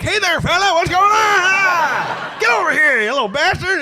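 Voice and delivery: hammed-up excited greeting, almost shouting; overeager grown-up self. Grown man greeting his eight-year-old self, high loud mock gush. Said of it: "and I'm just like".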